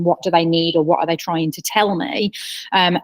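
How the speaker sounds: usual speaking voice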